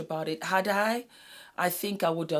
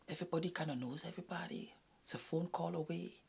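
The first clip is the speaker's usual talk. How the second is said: whisper; 10 dB quieter, faster than usual. Real, not acted. Confiding insider secret.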